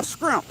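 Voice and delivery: "scrimp" with a hard k for sh; mispronounced shrimp. Retold or imitated. Quoting people who mispronounce shrimp, "scrimp" with a k. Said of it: "when I hear people say S K R I M P".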